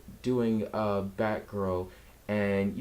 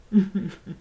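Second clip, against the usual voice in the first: laugh breaking through; 7 semitones higher, 5 dB louder than usual. Real, not acted. Breaks from fake crying.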